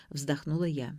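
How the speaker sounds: ordinary delivery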